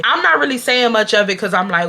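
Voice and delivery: usual speaking voice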